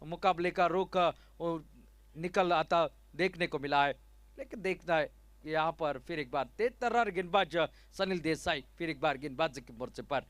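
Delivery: ordinary talk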